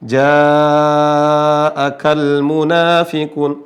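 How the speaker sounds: JA'AKAL chanted slowly with a stretched, open "aa"; thin long alif. Shown as a demonstration. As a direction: recite ja'akal munafiqun with sustained, unrounded vowels. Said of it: "Intonasi panjangnya alif menjadi tipis". (ja'akal munafiqun dilantunkan pelan, alif tipis)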